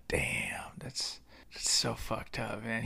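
hushed, breathless whisper of a stunned friend; whispered buddy reaction. Retold or imitated. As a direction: whisper it like a friend watching in shock. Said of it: "my buddy Juan was looking at me like".

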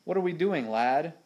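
ordinary delivery, nothing marked

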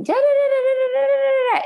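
high fast nonsense babble; mock arguing babble. Imitating everyone arguing at once, high babble. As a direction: gabble like a crowd fighting. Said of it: "they all start arguing".

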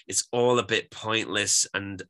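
normal talking voice

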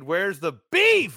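loud, slow demanding question; quoting the commercial. Retold or imitated. Quoting a famous commercial to his friend, loud and drawn out. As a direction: belt out the old ad line. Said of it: "To quote the best commercial ever made".

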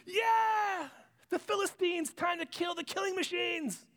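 high, excited jeer from the crowd; cheering crowd. Acted. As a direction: cheer like a gloating mob.

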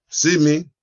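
loud call of "See me"; commanding call. Retold or imitated. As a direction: call out like a pastor at prayer. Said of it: "He prayed".

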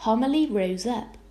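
ordinary delivery

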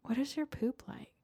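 hushed, breathy question; mock-intimate. Co-host prying into toilet habits, mock-intimate hush. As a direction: ask it in a near whisper.

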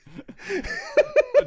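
squealing burst of laughter; high laugh. Real, not acted. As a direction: break up laughing. Laughing at own joke.

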